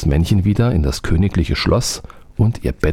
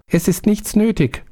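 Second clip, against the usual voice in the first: much higher little-spirit voice. A narrator's reading. Little man reports to the soldier, much higher. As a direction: report back like an eager tiny servant. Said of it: "sprach das Männchen".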